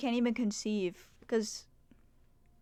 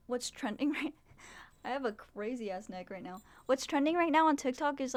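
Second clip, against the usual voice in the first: ordinary delivery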